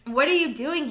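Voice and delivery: loud, high challenge; heated shout; angry demand. A narrator's reading. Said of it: "he demanded".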